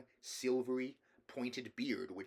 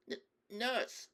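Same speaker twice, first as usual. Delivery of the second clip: put-on high, stammering little boy; frightened child forcing out a word; faltering; 13 semitones higher than usual. A narrator's reading. Nephew confessing to the angry king, faltering. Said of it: "faltered Caspian".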